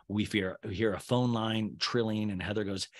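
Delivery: ordinary talk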